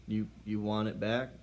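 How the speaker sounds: ordinary talk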